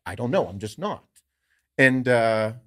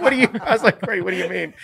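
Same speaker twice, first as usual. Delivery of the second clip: laughing disbelief; 8 semitones higher, 5 dB louder, faster than usual. Retold or imitated. Retelling his own laughing reaction.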